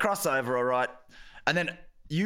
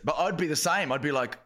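ordinary delivery

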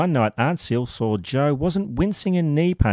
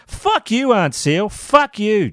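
much higher, louder furious yell, stress on Fuck; screamed back; 12 semitones higher, 5 dB louder, slower than usual. Retold or imitated. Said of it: "Joe screamed back".

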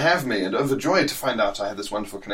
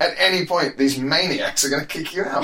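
much higher mock-scolding tirade; exasperated rant; 9 semitones higher, 3 dB louder than usual. To passport refusers, an exasperated mock rant. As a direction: lecture them in disbelief. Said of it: "And you go".

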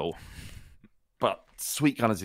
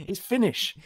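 radio host; 10 semitones higher than usual. Retold or imitated. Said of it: "And the bloke went".

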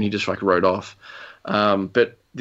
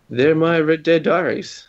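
slow, louder, higher title announcement; show sign-off; 6 semitones higher, 5 dB louder than usual. Pretend. Wrapping up her stories for the listeners, announcer style.

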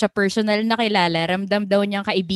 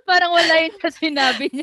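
laughing; 8 semitones higher, 5 dB louder than usual. Real, not acted. Laughing mock cry.

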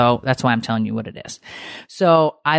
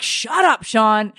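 high playful shut-up, much higher; mock snap; 7 semitones higher, much slower than usual. Wife telling friend to shut up, high playful snap. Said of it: "I'm like".

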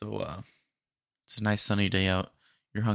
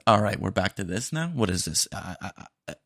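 normal talking voice

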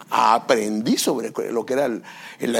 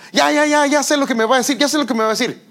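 imitando a un hijo harto, agudo y fuerte; impaciencia fastidiada; 11 semitones higher, 4 dB louder, faster than usual. Retold or imitated. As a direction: ya ya ya, como quien no quiere oír. Niño fastidiado ignorando al padre. (imitación de hijo fastidiado)

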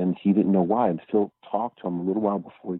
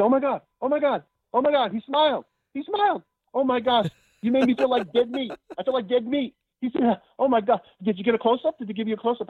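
much higher, gushing excited impression; Robin gushing excitedly. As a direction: squeal it out like an overjoyed star. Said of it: "he went crazy".

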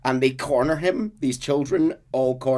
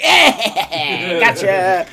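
high, loud, laughing gotcha; spring the joke with a cackle; gleeful prank reveal; 10 semitones higher, 10 dB louder, much slower than usual. Retold or imitated. Dutch springs his prank on Arthur, high, loud and laughing.

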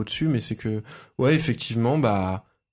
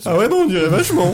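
laughing; 7 semitones higher, 7 dB louder, faster than usual. Real, not acted. Teasing reply, bursting out laughing.

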